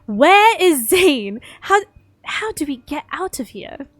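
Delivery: loud, high, overdone British; bad British accent. Trapped heroine asks the way out, in bad British. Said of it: "deal with this shitty accent".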